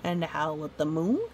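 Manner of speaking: ordinary delivery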